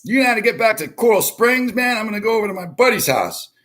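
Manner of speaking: put-on retelling a drunk friend's phone question in a high voice; imitating the friend. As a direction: mimic him asking for directions, fast and high. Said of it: "He's like".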